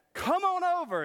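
high, loud come-on-over call; a cheery restaurant host calling; mock beckoning. A waiter in a vision beckoning churchgoers, high and loud.

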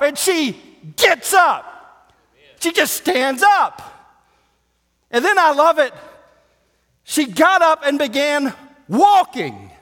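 loud, high, shouted sermon; fiery preacher at full volume; full-force preaching. Real, not acted. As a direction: preach it hard to the back row.

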